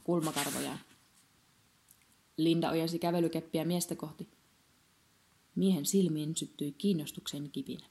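ordinary delivery

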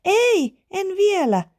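put-on very high, piping child's call; high squealed refusal; little girl shouting back. A narrator's reading. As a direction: call down in a small girl's high voice. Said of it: "Iida huusi".

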